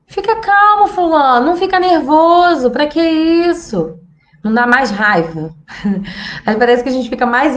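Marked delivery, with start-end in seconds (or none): 0.1-4.0 condescending calming. Retold or imitated. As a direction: imitate someone telling you to calm down. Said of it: "alguém vira pra você e fala assim". (alguém te acalmando de forma irritante, agudo e mais alto)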